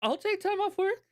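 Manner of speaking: mock indignant. Mock outrage at girlfriend.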